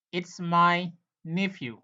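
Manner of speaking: "It's my nephew" said slowly, word by word; slow, careful "It's my nephew" for the class; slow teaching model. Shown as a demonstration. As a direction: spell it out slowly for learners. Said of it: "teacher repeats model sentence slowly".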